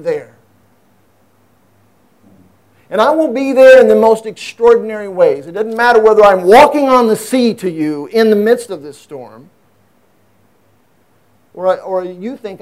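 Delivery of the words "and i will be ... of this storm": higher, louder preaching push; impassioned preaching. Acted. Pastor urging his congregation, in full-force preaching. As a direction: preach it with rising fervor.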